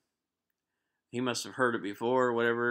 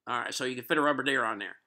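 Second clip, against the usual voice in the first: quick, higher-pitched Southern twang; worst southern accent; 4 semitones higher, 4 dB louder, faster than usual. Retold or imitated. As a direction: imitate a thick-accented Southern customer. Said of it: "the worst southern accent that you could possibly".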